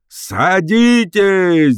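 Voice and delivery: thin, high, long-drawn wail; howling loudspeaker voice. A narrator's reading. Talking newspaper blaring over the crowd, thin howl. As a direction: stretch it out in an unpleasant high howl. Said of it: "завыл вдруг в рупоре".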